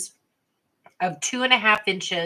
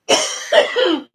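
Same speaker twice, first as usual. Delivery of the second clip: harsh airless cough, pretzel stuck in the throat; real choking cough; 9 semitones higher, 7 dB louder than usual. Real, not acted. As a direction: cough hard to clear the throat. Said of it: "pretzel stuck in my throat".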